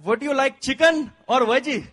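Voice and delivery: flight attendant. Retold or imitated. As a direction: call it down the aisle like a steward. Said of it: "guy came to me and said".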